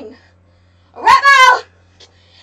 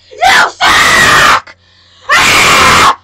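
big yelled taunt, louder; loud pretend battle yell; 8 dB louder, much slower than usual. Kid taunting Taylor in pretend fight, loud yell. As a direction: yell it at the rival in play.

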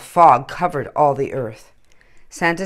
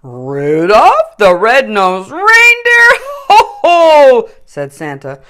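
louder, slow, proud laughing Santa; jolly Santa; 3 semitones higher, 10 dB louder, slower than usual. A narrator's reading. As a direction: crown him with a hearty boom. Santa's voice in story.

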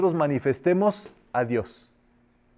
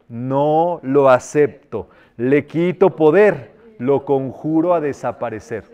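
slow, firm, louder refusal; firm rejection decree; 5 dB louder, slower than usual. Shown as a demonstration. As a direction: stamp out each word, no room for doubt. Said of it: "A todo negativo le vas a decir". (rechazo firme y pausado)

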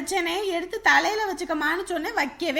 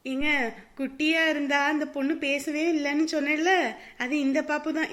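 ordinary talk, nothing marked

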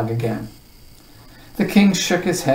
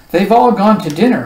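ordinary delivery, nothing marked

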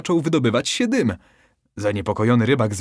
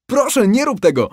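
high, loud desperate plea; begging; 12 semitones higher, 7 dB louder than usual. Acted. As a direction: beg for your life. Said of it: "błagał rybak".

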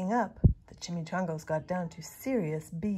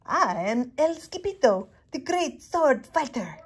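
higher, loud proud swagger; boastful hero; 5 semitones higher, 6 dB louder than usual. A narrator's reading. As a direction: announce yourself grandly. Hero boasts his name.

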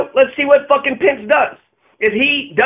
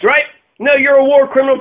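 normal talking voice

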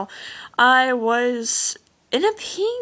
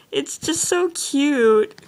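slow and a little higher, stretching "so cute"; gushing, drawn out; 5 semitones higher, 3 dB louder, much slower than usual. Real, not acted. Vlogger gushing over an eight-year-old's drawings, slow. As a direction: linger on the cuteness, almost cooing.

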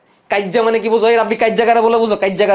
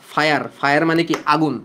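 ordinary delivery

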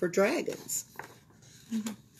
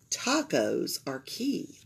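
ordinary delivery, nothing marked

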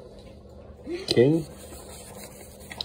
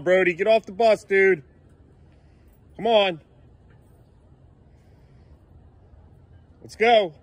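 loud, high call to the bus; dad calling his son off the school bus; 10 semitones higher, 4 dB louder than usual. Real, not acted. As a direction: call him off the bus from the yard.

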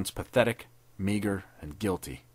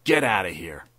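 threat; 2 semitones lower, 5 dB louder than usual. A narrator's reading. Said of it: "was the threat".

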